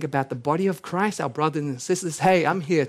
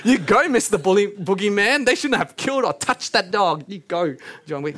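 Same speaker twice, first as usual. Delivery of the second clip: loud mock cheer for the hero; hammed-up cheering crowd; 4 semitones higher, 4 dB louder than usual. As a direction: egg him on like a fan in the stands. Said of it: "they're kind of cheering".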